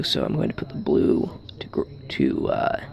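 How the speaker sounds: half-whispered to himself; whispering. Real, not acted. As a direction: drop to a whisper while concentrating. Muttering to himself while wiring.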